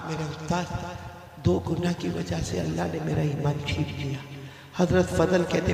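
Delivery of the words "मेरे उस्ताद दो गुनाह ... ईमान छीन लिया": hushed confession. Retold or imitated. Dead student confessing his sins to his teacher, hushed.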